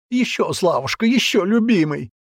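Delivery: high, thin mimicry of a lover; thin mocking voice. Man taunting his ex-lover, thin mocking falsetto. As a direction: mockingly squeal like the girl in passion. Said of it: "Тонким голоском передразнил он".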